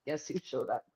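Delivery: teasing the listener, low and sly. Shown as a demonstration. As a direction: drop it down low and knowing.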